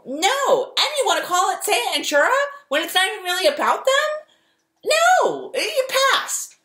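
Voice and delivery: high, fast dismissive executive; network executive voice. Pretend. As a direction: reject the pitch, up high. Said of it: "That's what I say as".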